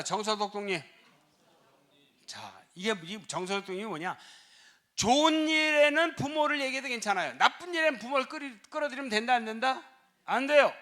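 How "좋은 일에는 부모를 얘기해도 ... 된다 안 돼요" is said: high, loud pulpit emphasis; fervent sermon delivery; emphatic preaching. Acted. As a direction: preach it hard at the congregation. Preacher driving a point home.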